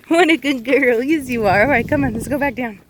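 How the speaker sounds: excited pet talk easing into a call; puppy praise. Real, not acted. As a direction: coo at the dog, then coax her back down.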